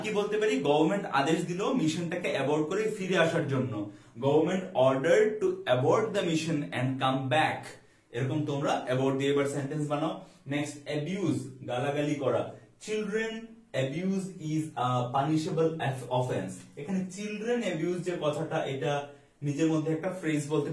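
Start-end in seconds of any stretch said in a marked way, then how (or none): 4.2-7.6 slow dictation. Shown as a demonstration. Teacher reads example sentence slowly. 12.9-16.5 slow dictation. Shown as a demonstration. Teacher reads example sentence slowly.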